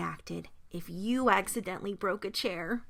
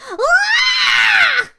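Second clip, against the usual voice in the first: piercingly high, loud scream; startled scream; 14 dB louder than usual. A narrator's reading. Goldilocks waking to see three bears, screaming. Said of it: "let out a noise that startled".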